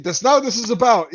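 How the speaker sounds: mock an outraged Brit protesting; angry tirade. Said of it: "he starts going on this tirade".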